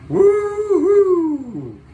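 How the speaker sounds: high, loud whoop of delight; excited cheer. Real, not acted. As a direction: cheer the jet on at full voice. Cheering the final performance.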